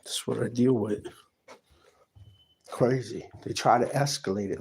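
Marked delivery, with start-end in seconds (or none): none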